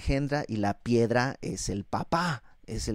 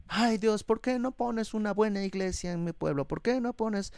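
mock whining prayer; 7 semitones higher, 4 dB quieter than usual. Believer whining to God.

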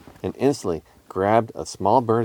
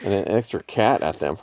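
usual speaking voice

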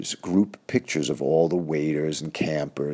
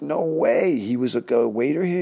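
stunned disbelief; 9 semitones higher, 4 dB louder than usual. Retold or imitated. Shock at drummer photo reveal.

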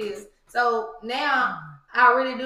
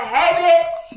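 mock greeting; 5 semitones higher, 7 dB louder than usual. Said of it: "They like".

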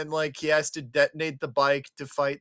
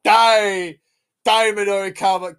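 loud, raised villain yell; mock battle cry; 4 semitones higher, 11 dB louder than usual. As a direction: bellow it like a cartoon attacker. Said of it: "and he's like".

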